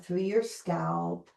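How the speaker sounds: ordinary talk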